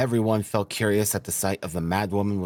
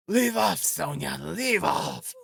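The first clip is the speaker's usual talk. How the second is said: high, frantic shouted command; shouting; 9 semitones higher, slower than usual. A narrator's reading. Mother ordering Sonia to leave off, shouting. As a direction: shout it shrilly, drawing out the last words. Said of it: "she shouted".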